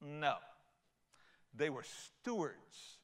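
ordinary talk

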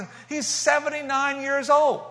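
loud, high, pumped-up telling; real thrill in the voice; excited. Real, not acted. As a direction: burst with joy at the news. Excited retelling meeting the old man.

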